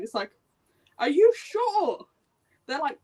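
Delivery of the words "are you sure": doubtful question. Retold or imitated. Doubting the characters' claim.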